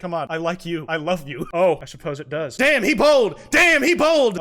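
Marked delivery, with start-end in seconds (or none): 2.6-3.4 loud, high hyped shout; hyped yell. Real, not acted. Streamer hyped by the butler's confession, loud. 3.5-4.4 even louder, high hyped shout; hyped yell. Real, not acted. Streamer shouting at the confession, loud.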